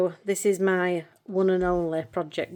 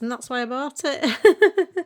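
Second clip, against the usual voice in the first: higher, louder, breaking into a laugh; 7 semitones higher, 5 dB louder, slower than usual. Real, not acted. Embarrassed at tearing up on camera, laughs it off. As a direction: laugh through it. Laughs at her own emotion.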